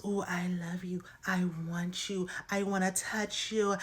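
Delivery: breathy, hushed come-on; teasing whispery imitation of a lover; mock seduction. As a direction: coo it softly like an exaggerated seductress. Mimicking Lydia's seductive words to Milton.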